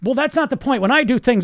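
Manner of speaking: high, loud perfectionist protest; mock defensive attitude. Dieter answering how much weight they lost, mock defensive. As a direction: argue back as an indignant perfectionist, hammed-up. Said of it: "you get this attitude".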